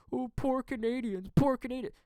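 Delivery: high-pitched mocking pity; mock sob story. Mimicking the media's sob story, high mock pity. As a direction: wail it in exaggerated fake sympathy. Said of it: "this sob story that it".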